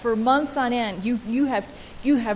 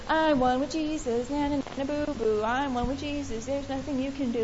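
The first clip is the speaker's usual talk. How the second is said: singsong taunt; 7 dB quieter than usual. Retold or imitated. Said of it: "walk around it and say".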